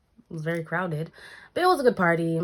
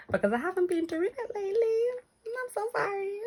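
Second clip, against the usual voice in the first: mock crying apology; 4 dB quieter than usual. Apologising to viewers for absence.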